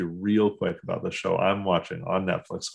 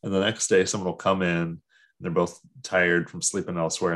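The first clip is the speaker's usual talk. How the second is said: usual speaking voice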